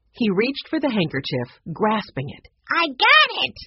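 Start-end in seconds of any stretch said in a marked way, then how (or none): 2.7-3.6 much higher, louder cry of victory; triumphant shout. A narrator's reading. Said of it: "he shouted triumphantly".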